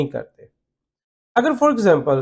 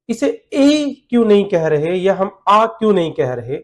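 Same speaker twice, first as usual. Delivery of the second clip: ordinary talk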